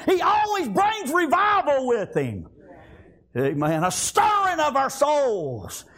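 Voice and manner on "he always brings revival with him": raised, ringing preacher's call, pitched high; preaching at full force. Acted. Pastor rousing his congregation, full-force preaching. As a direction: lift the church with a rising cry.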